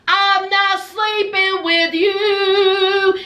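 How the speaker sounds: very slow, sing-song and stretched; drawn-out refusal. Retold or imitated. Man refusing to share a bed, drawn-out sing-song. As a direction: hold the last word long on one note.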